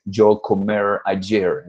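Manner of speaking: halting, very slow voice with hesitations; broken Spanish foreigner. Retold or imitated. Teacher imitating a foreigner who misuses the past. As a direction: stumble like a foreigner struggling with Spanish. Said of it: "hablando así".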